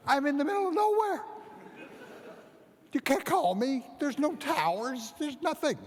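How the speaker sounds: can't believe the phone rings; amazed. Retold or imitated.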